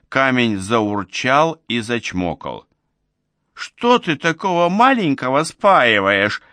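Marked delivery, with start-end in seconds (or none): 3.5-6.4 high-pitched, fussing reproach; noisy scolding. A narrator's reading. Old woman protests at liquor splashed on the stone, noisy scolding. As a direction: kick up a fuss in protest. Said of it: "зашумела Никитишна".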